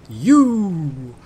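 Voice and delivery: drawn-out, swooping "Yu" like a lottery announcer; booming slogan voice. Acted. Commentator introduces the newcomer Yu, booming slogan voice. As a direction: boom out a long, sliding "Yu". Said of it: "my brain wants to go".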